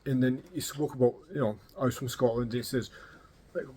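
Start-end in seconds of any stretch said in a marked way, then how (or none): none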